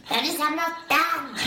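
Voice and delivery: high, slow mock yell, dripping wet; retold scream. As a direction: yell it again as you did back then. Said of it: "tittar jag upp så här helt blöt och så bara".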